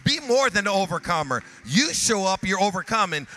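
high, loud shouted exhortation; shouting preacher at the peak; full-force preaching climax. Acted. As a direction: build the crowd to a roar.

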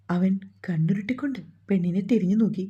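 plain reading voice

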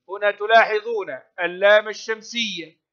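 ordinary talk, nothing marked

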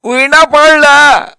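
put-on high, drawn-out old granny voice; high-pitched puzzled old woman; toothless granny. A narrator's reading. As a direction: query it like a hard-of-hearing grandma. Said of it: "అంది బోసినోటితో".

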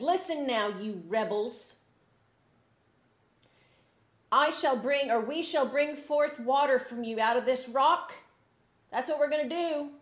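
loud, high, furious rebuke to the crowd; angry shout. Acted. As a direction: bellow at the rebels in fury. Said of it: "He's getting really angry".

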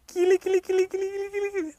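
very high tickle chant; tickling coo. Acted. Röskva tickling the wolf, very high coo.

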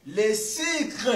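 "sucre" with an "i" sound, like "sikl"; Congolese-accented pronunciation. Shown as a demonstration. Guest proves his accent on the word "sucre", pronounced "sicre". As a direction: say "sucre" as "sicre", vowel unrounded. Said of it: "prononce le sucre".

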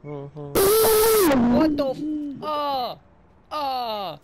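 high, drawn-out cry of alarm; startled yelling. Real, not acted. Gamer hit by a mic spike, startled yelling. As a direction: yell out high as your ears get blasted. My ears.

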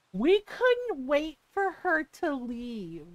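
imitating a smug neighbor; mock snooty neighbor. Neighbors voiced mockingly.

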